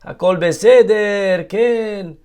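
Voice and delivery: relaxed Spanish Israeli reassurance; lightly voiced Spanish Israeli accent. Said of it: "he had the Spanish Israeli accent".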